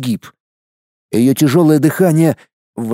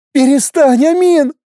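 angry crying; 12 semitones higher than usual. Acted. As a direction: beg through choking sobs. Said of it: "захлёбываясь слезами". (мольба сквозь рыдания)

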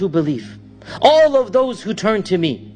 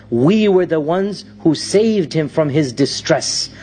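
ordinary delivery

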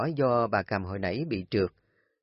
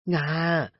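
weak scolding call; 5 semitones higher than usual. Acted. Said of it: "Bà Hoạt quát con Nga".

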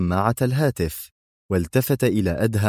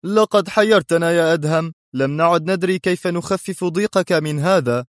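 high, exasperated ambassador; mildly angry, raised pitch; 9 semitones higher than usual. A narrator's reading. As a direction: scold him in annoyance. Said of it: "قال السفير في غضب".

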